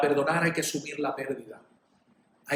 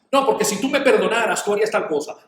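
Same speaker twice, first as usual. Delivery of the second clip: loud, high, protesting insistence; pushy mock complaint; acted manipulation; 4 semitones higher, 7 dB louder than usual. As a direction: pressure them indignantly after being told no. Acting the manipulator pressuring after refusal.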